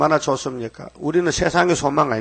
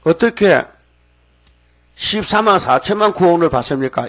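a hint of an anxious caller, higher and louder; caller's question; 5 semitones higher, 4 dB louder than usual. Preacher mimicking a caller asking about the 144,000. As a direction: lightly voice the worried questioner. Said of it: "이건 이제 질문이".

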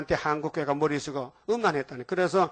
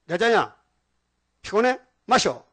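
louder, higher commanding call from a vision; voice of Paul; 4 semitones higher, 7 dB louder than usual. Retold or imitated. Paul in the vision ordering the tired pastor to drink, loud and high. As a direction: urge the exhausted man to drink, loud and high.

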